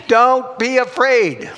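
high, loud, slow declaration; emphatic proclamation. Acted. Preacher passing on Jesus' words this morning, emphatic proclamation. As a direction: proclaim it slowly with full conviction.

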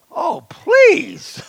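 high, loud, playful oh please; mock exasperation. Real, not acted. Preacher to congregation, loud playful exasperation. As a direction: brush it off with a laugh. Dismissing sins talk, laughing.

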